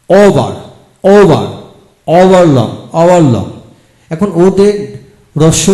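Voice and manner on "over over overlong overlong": "over" and "overlong" said loud with a long o; teacher drills "overlong" clearly; loud model pronunciation. Shown as a demonstration. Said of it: "ঔকার দিয়ে". (জোরে স্পষ্ট "overlong")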